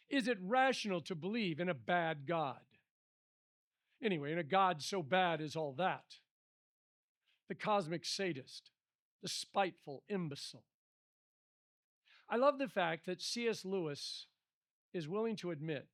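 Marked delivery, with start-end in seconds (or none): 0.1-10.7 loud, forceful reading of an angry complaint; restrained version of yelling at God; mild quoted yell. Acted. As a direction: argue with God in a raised voice. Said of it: "I was busy yelling".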